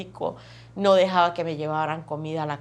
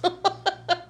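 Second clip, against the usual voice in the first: una risa corta y aguda; ríe al recordar a su esposo; 11 semitones higher than usual. Real, not acted. (risita aguda y breve)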